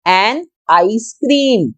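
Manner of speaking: "An आइसक्रीम" said slowly with a clear "आई" start; teacher showing the "आई" sound; slow example word. Shown as a demonstration. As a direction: model "An आइसक्रीम" for the class. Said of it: "आई की sound है आई".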